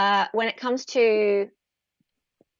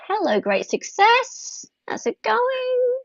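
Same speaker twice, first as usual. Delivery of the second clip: mock greeting; 13 semitones higher, much slower than usual. Greeting stream viewers in a voice.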